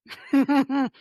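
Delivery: real burst of loud knowing laughter; a hearty laugh of recognition. Real, not acted. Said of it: "I'm laughing 'cause I used to do it".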